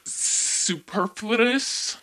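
superfluous stretched very slowly, rising; drawn-out word. Pretend. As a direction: savor each syllable of superfluous. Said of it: "Or should I say".

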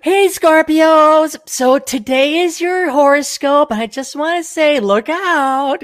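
high, slow sultry horoscope host; mystical horoscope reading. Pretend. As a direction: purr the reading to Scorpios, slowly. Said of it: "and she comes in".

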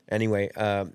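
normal talking voice

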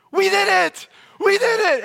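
very high, loud victory yell; Michael. Retold or imitated. Michael joins Dwight's celebration, yelling jubilantly. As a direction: shriek it in triumph. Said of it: "they start high-fiving, and they're celebrating".

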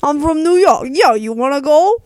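loud, badly faked New York accent; hammed-up New York accent; terrible New York accent. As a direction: do a bad New York tough talker. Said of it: "That was a terrible New York accent".

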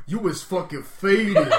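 loud, drawn-out mocking copy through laughter; imitating her voice. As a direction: mimic it slowly, cracking up. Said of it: "say it in that voice".

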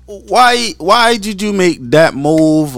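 normal talking voice

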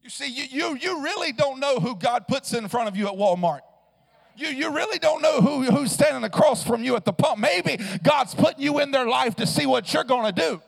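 loud, fervent sermon cadence; full-throated revival preaching; preaching at full force. Acted. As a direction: lift the room with it.

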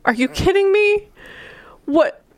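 high-pitched mock outrage, stretched at the end; acted indignation. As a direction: protest incredulously, voice jumping up.

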